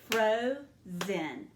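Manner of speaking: "Frozen" split into two clapped beats, FRO and ZEN; clapped syllables. Shown as a demonstration. Teacher clapping frozen out syllable by syllable. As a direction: clap out "Frozen" beat by beat. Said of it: "Let's clap it out".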